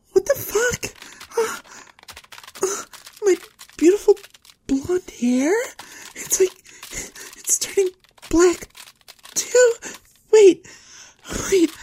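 very high, tearful panic; halting words, climbing in pitch and volume; panicked horror. Acted.

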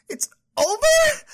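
high, loud outraged disbelief; shocked disbelief. Acted. Dumped girlfriend shrieking at the breakup text in disbelief.

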